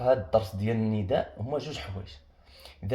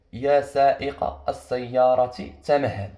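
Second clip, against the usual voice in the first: slow example reading; much slower than usual. Shown as a demonstration. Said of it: "قلنا المثال التالي".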